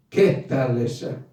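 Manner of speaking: normal talking voice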